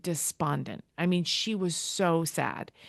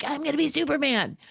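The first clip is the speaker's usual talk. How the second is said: higher, heroic self-mocking boast; 9 semitones higher than usual. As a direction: puff up like a comic-book hero. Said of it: "I was like".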